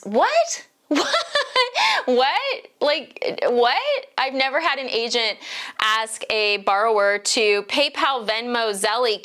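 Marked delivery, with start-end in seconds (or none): 0.0-4.1 high incredulous What, What with a laugh; what?! over and over, rising and baffled; stunned disbelief. Retold or imitated. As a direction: squeak out disbelief at the text. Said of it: "my response was".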